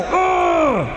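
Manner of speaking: frustrated groan. Retold or imitated. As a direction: let out a disgusted shout of frustration.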